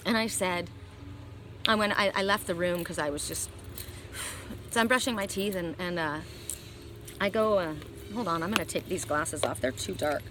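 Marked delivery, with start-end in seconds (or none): 3.5-4.7 heavy sigh. Real, not acted. Overwhelmed after crying.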